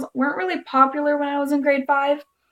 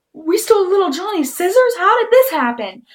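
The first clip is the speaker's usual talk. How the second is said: higher, startled questioning voice; surprised mom; 8 semitones higher, 4 dB louder than usual. Retold or imitated. Mom noticing the name on the scissors, surprised. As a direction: ask it like a mom finding something odd. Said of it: "she's like".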